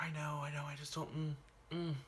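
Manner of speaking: soft, choked reply. Retold or imitated. Boy answering his worried friend, softly choked up. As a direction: hold back tears while you answer.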